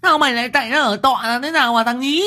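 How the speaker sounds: very high, shrill wailing woman's voice; frantic mother wailing at her son; shrieking mother. A narrator's reading. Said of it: "Liên đã lại rú lên".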